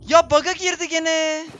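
real exasperated whine. Real, not acted. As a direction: complain bitterly about the bug. Death by bug, exasperated.

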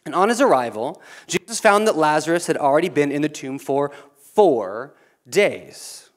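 ordinary talk, nothing marked

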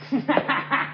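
quiet short laugh; chuckle. Acted. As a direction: laugh softly at your own line. Laughs after own catchphrase.

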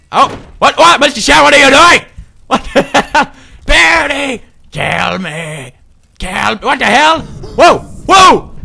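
high frantic shrieks; terrified yelling. Acted. Gamer shrieking at a monster, panicked yells.

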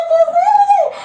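high-pitched, overwhelmed cry; excited disbelief. Real, not acted. Streamer saying hands and feet tremble, excited disbelief. Elim ayağım titriyor.

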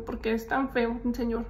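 ordinary delivery

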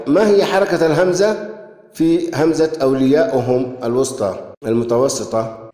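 ordinary talk, nothing marked

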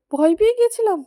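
ordinary talk, nothing marked

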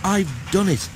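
winded but triumphant; gasping triumph. A narrator's reading. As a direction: gasp it out triumphantly. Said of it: "he gasped triumphantly".

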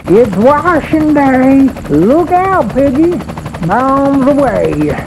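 very high, loud drawn-out battle cry; excited cry. A narrator's reading. Farmer swoops over pigs in plane, high gleeful holler. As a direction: holler it out like a dive-bombing farmer. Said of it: "Farmer cried".

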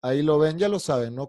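ordinary talk, nothing marked

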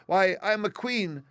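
high, loud, squeaky proclamation; indignant mouse queen. A narrator's reading. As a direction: announce royal rank in an offended squeak, slowing grandly. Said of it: "cried the little animal indignantly".

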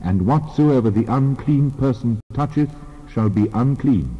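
ordinary delivery

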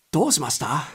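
a captain checking on a passenger; lightly voiced officer; captain's question. Captain arrives asking.

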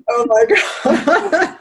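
high, drawn-out words through laughter; amused, high-pitched disbelief; laughing amazement. Retold or imitated. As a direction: retell a delighted discovery, slowly and giggling. Retold delight at the name.

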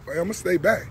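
ordinary talk, nothing marked

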